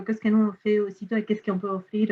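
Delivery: ordinary talk, nothing marked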